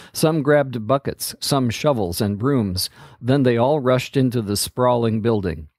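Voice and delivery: plain reading voice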